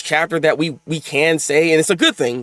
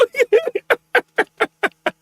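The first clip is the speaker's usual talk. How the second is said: very high-pitched giggle; laugh. Real, not acted. Giggling at the double meaning.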